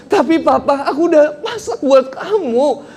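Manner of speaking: high, drawn-out whiny complaint; mock wailing wife. Wife complains to husband about her cooking, high mock wail.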